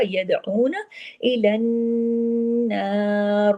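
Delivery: drawn-out Quranic phrase; slow recitation. Shown as a demonstration. Teacher reciting for students to follow. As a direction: stretch each syllable evenly. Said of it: "teacher demonstrating recitation".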